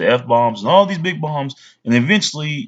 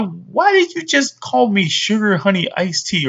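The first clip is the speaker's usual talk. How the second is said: higher, slow puzzled question; baffled bully; 7 semitones higher, slower than usual. Pretend. Bully puzzled by the fake cuss, slow and high. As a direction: act confused by the odd phrase. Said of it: "They're gonna wonder".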